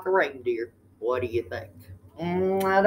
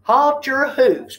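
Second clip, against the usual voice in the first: louder, slow halt call; elf crying out; 6 semitones higher, 5 dB louder, slower than usual. A narrator's reading. Elf stopping the reindeer turkey, slow and loud. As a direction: stop him in his tracks. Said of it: "cried the elf".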